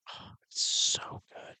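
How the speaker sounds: breathy hushed whisper. Real, not acted. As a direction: whisper it like a secret treat. Savoring favorite drink.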